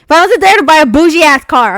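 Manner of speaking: loud and high emphasis; emphatic. Real, not acted. Insisting to viewers she wanted a used car, loud. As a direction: stress it hard.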